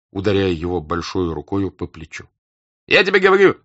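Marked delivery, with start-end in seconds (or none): 2.9-3.6 high, loud, insistent cry; raised, emphatic yell; excited shout. A narrator's reading. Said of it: "закричал Денисов".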